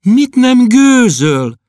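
put-on high, slow girlish question; a little girl asking in alarm; girl's voice. Klopédia bursting in, asking in a high girlish voice.

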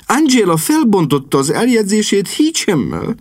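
agitated. Acted. Sister breaks bad news.

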